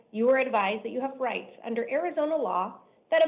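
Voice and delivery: ordinary delivery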